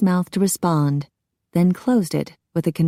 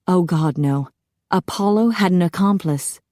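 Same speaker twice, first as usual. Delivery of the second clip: plain reading voice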